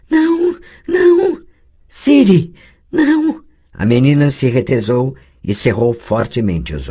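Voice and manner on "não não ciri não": high, loud shrieking no's; the girl screaming out her own name in panic; terrified scream. Acted. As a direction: scream it as if the horror is here. Screaming in terror.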